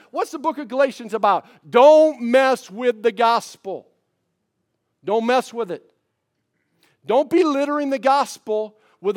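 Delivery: loud, high, hammering preacher's delivery; full-force preaching. Acted. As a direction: drive the slogan home to the church. Preacher's loud slogan section.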